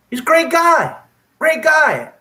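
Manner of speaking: emphatic. Real, not acted. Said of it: "emphatic praise".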